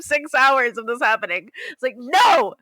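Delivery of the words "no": high, loud correction; exasperated shout. Retold or imitated. Telling a friend it is an engagement, exasperated shout. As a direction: shout the news over a wrong guess.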